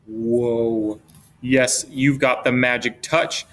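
ordinary delivery

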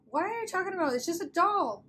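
high-pitched, exasperated disbelief; incredulous. Acted. Acted scoffing at fear of doll.

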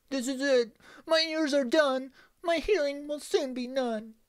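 high, loud terrified wail; panicked cry. Acted. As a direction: shriek it in terror. Ronald facing the axe.

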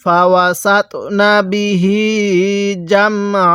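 measured chant with the last "hee" of বিহি held; slow Quran recitation. Shown as a demonstration. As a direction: recite slowly, stretching the end of বিহি. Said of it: "হ্যাঁ, পড়ুন".

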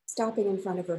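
ordinary delivery

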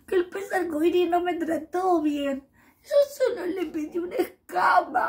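high, wobbly near-crying voice; hurt and about to cry; little fish tells friends he was mistreated, tearful. Acted.

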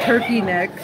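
ordinary delivery

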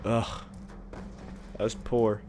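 ordinary delivery

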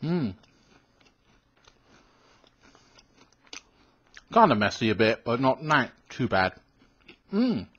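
chewing between words; talking while chewing. Real, not acted. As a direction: mouth partly full of cookie. Seen 3: Eating before, rubbing crumbs off fingers, mouth working while talking.

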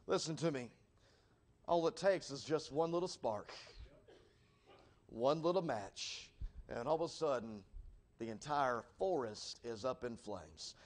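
low, soft and slowing, with long pauses; hushed grave warning. Real, not acted. Preacher warning how one spark burns a forest, hushed and grave.

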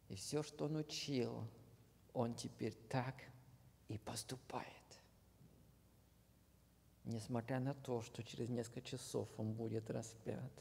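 usual speaking voice

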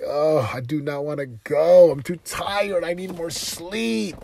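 mock groggy complaining; whiny tired inner voice. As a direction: whine like you can't get out of bed.

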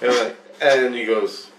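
normal talking voice

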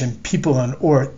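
plain reading voice

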